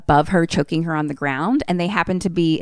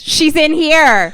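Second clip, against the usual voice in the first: high, loud, slow drawn-out yell; 11 semitones higher, 7 dB louder, much slower than usual. Retold or imitated. Alex alerting the family that she's here, yelling. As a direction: yell it out to summon everyone. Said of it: "And then he yells".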